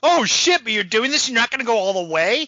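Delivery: high, loud incredulous question; mock outrage. Viewer let down by half-hearted acting, mock outrage. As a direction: challenge them in exaggerated disbelief. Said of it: "And like".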